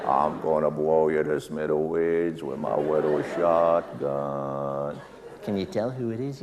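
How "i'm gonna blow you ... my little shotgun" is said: low, very slow cartoon hunter threat. Comic keeping the cartoon hunter voice for the guessing game, low and slow. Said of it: "But this one you gotta guess".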